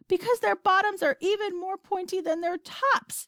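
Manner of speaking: high, urgent explanation; shouting. A narrator's reading. Astronaut explaining to the president, shouting. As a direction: call it out, pitch held up. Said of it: "shouted Shuckworth".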